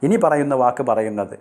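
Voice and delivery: ordinary talk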